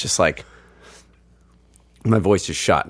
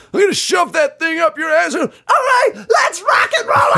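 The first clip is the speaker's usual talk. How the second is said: [name] rant. Retold or imitated. Mimicking a rock frontman hyping the arena crowd, high shouted. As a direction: whip the crowd up like a rock star. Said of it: "at the end of his rant".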